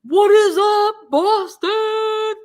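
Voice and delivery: high, loud, slow rowdy hype yell; rowdy crowd call. Pretend. Rowdy fan yelling to Boston from courthouse steps. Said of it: "on those steps saying".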